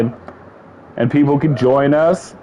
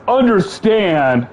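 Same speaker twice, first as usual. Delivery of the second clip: UNDERSTAND drawn out slowly at a higher pitch; mocking capitals; 6 semitones higher, much slower than usual. Reading out a tweet that mocked him, UNDERSTAND in mocking emphasis. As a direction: stretch and lift UNDERSTAND as if in all capitals. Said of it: "So he's mocking me".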